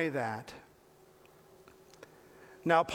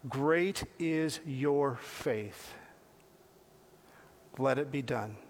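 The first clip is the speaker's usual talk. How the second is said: slow tender praise; 5 semitones lower, 3 dB quieter, slower than usual. Retold or imitated. Jesus praising the woman.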